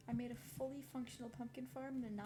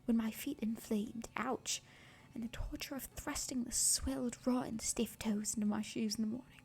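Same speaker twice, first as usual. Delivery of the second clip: whispered reading; 2 semitones lower, 9 dB louder than usual. Real, not acted. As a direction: whisper the passage gently, like ASMR. Whispered reading of Jane Eyre.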